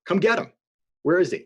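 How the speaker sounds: ordinary talk, nothing marked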